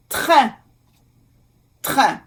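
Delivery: "train" in French, nasal vowel, silent n; careful French pronunciation. Shown as a demonstration. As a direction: model "train" with a nasal ending. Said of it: "So, A I N".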